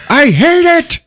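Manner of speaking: high, loud mock yell; comic imitation of a hating outburst; retold outburst. Quoting Heather's reaction to film.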